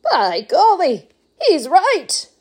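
high, loud delighted grandpa; amazed old man. Acted. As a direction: marvel at it. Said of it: "said Grandpa Joe".

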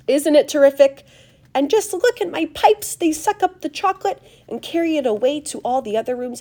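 high, loud showman's boast; proud, animated factory owner; excited Wonka. A narrator's reading. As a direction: show off your marvels with gusto.

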